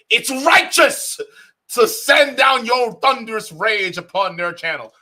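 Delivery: high, loud, slow hammed-up declaration; mock thunderous proclamation. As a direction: boom it out like a mock prophet. Mocking grandiose righteous anger.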